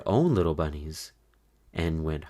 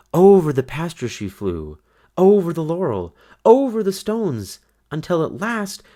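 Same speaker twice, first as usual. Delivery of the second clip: each "over" climbing higher and louder, then easing off; building excitement; 9 semitones higher, 7 dB louder than usual. A narrator's reading. Narrator telling the magical flight, rising excitement. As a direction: let the thrill of flight lift the voice. Bunny flying high over pasture.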